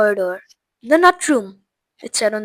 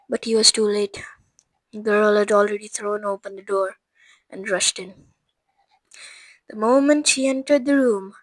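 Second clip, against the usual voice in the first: ordinary delivery, nothing marked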